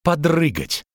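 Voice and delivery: high strained groan. A narrator's reading. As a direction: groan it out with effort, lightly acted. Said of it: "простонала она".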